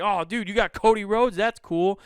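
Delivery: excited. Retold or imitated. Excited reaction to toy.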